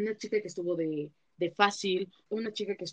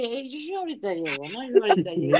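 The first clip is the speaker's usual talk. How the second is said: mock drunk reply; 3 semitones higher, 5 dB louder than usual. Drunk reply to mom's call.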